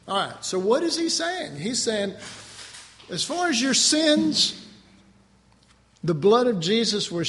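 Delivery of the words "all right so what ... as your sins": ordinary delivery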